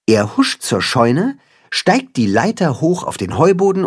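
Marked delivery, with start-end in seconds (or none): none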